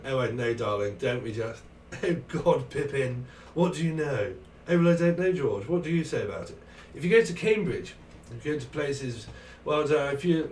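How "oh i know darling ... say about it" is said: hammed-up posh British chit-chat; mimicked British accent. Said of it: "Still love to mimic the British accent".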